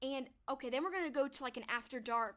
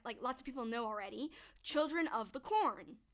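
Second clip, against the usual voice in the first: ordinary talk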